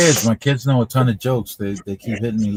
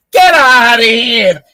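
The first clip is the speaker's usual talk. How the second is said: bartender scoffing; 12 semitones higher, 10 dB louder, much slower than usual. Retold or imitated. Bartender in disbelief.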